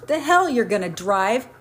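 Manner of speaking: high, loud, incredulous protest; indignant outburst. A narrator's reading. Undertaker confronts his drunk friend over the bill, indignant. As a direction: raise your voice and challenge him.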